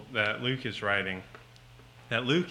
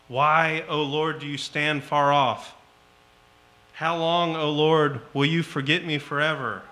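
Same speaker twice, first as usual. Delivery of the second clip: loud, pleading cry to God, higher; psalm cried out loud; 3 semitones higher, 8 dB louder than usual. Acted. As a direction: call out the psalm like a desperate plea. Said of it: "yelling these things at God".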